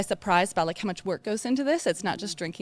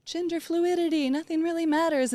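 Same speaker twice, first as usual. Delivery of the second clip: much higher, breezy shrug of a voice; carefree young voice; 10 semitones higher than usual. Retold or imitated. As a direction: toss it off like a carefree kid. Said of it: "is definitely like".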